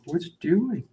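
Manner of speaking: high, slow puzzled voice for the pet; high slow mock bewilderment; voicing the snake. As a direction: voice the pet's confused thoughts, drawn out. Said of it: "You're like".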